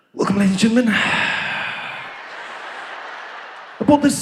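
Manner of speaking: pilot voice, gasping between words; breathy pilot voice. Retold or imitated. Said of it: "they always do that thing of theirs".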